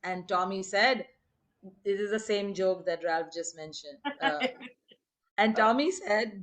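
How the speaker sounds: plain reading voice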